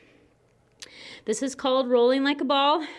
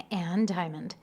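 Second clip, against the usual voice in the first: soft unvoiced whisper; whispered cue; 6 semitones lower, 5 dB quieter, slower than usual. Real, not acted. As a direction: breathe the cue out quietly. Quiet cue during leg movement.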